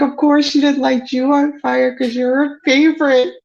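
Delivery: high, far put-on whiny voice; mock cousin's voice. Cousin Christy sulking about being Nana's favorite, high whiny mimicry. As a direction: mimic the cousin's indignant whine. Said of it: "And she's like".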